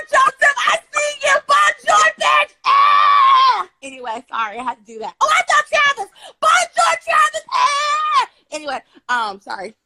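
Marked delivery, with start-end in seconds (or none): 0.1-3.7 very high, loud yell across the yard; calling out. Real, not acted. Calling out to a passing neighbour, shouting. 5.2-8.3 very high, loud yell across the yard; calling out. Real, not acted. Calling out to a passing neighbour, shouting. Shouting greeting to another passerby.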